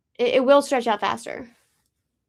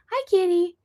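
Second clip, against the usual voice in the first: higher sing-song pet talk; talking to a cat; 7 semitones higher than usual. Real, not acted. Greeting the cat in a higher pet voice. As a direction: coo hello to the kitty.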